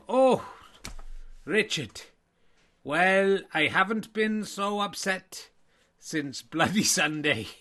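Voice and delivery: a hammed-up Irish voice; Irish accent. Irish me answering Richard's question in a mock Irish accent. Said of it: "do the voice".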